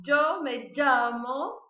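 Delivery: yo me llamo, llamo said as lhamo; slow Portuguese-style lhamo. Shown as a demonstration. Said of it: "Você pode tentar falar".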